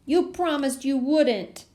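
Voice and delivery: put-on high, slow childish whine; whining. A narrator's reading. Little boy reminding Elaine of her promise, whining. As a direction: whine like a wronged little kid. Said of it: "Daniel whined".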